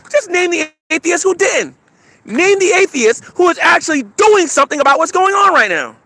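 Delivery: loud indignant call-out; angry rant. Real, not acted. Heated rant at atheists.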